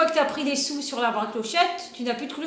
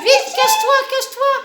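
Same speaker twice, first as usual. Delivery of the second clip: high, loud hurried urging; excited urging; 11 semitones higher, 7 dB louder than usual. Real, not acted. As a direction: hurry them into hiding. Parent urging to hide.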